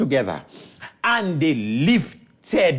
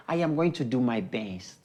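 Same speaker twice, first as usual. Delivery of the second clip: low, soft answer; quiet humble reply; 8 semitones lower, 8 dB quieter than usual. Acted. Cat replies to mocking villagers, quiet and humble. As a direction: answer the scoffers softly and low.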